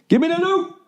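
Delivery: high squeaky cry for the loot; mock greedy squeal. Ad read, mock exclamation.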